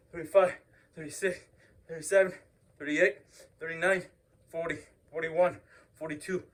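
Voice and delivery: ordinary talk, nothing marked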